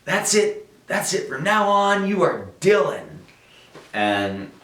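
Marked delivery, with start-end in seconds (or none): 0.0-3.0 high-pitched, loud imitation of a yelling publicist; excited yelling. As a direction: yell it out high, like a proclamation. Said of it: "she's yelling".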